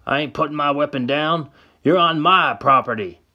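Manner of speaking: put-on high, loud old man voice; old man yelling. Old man warns Sam off his property, yelling high. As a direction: bark it out from the porch. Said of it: "yells the old man".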